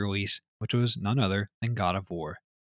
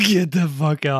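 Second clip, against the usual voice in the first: high, loud, incredulous laugh-shout; amused disbelief; 5 semitones higher, 5 dB louder than usual. Real, not acted. Co-host hearing another silly banknote name, loudly incredulous. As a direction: burst out disbelieving, still laughing. Disbelief at Jolly Green Giant.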